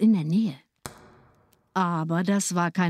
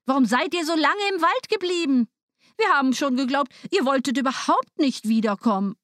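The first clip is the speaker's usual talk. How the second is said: much higher, pressed scolding; scolding stepmother; 7 semitones higher than usual. A narrator's reading. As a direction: complain at them, fake worry. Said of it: "rief sie".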